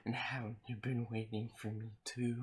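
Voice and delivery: sweet creepy coaxing. Acted. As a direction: coax softly like a creepy admirer. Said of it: "read this in a creepy guy voice".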